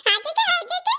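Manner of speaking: laughing mockery. Niece laughing as she mocks her aunt's words.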